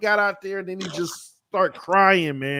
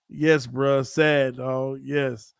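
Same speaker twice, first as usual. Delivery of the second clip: ordinary delivery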